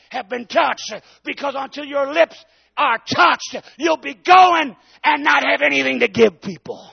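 high, loud, fervent exhortation; preacher fired up about touched lips; preaching at full force. Real, not acted. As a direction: drive it home with a shout. Preaching climax.

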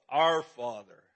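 "our" hit loud, "Father" falling; stress on our. Shown as a demonstration. Preacher correcting himself to stress "our" before Father, loudly. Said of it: "Not the Fa".

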